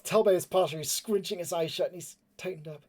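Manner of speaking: ordinary talk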